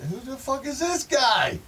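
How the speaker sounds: high, loud panicked yell; a hammed-up shriek of disbelief; mock freaked-out shout. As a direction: yell it in total confusion and alarm. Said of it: "I was like".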